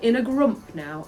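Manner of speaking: ordinary delivery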